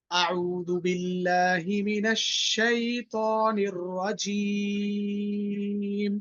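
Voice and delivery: slow, melodic tajweed recitation with a long held final vowel; measured chanting recitation, the last word drawn out; Quranic recitation. Shown as a demonstration. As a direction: recite it slowly for the class to echo. Teacher recites for class to repeat.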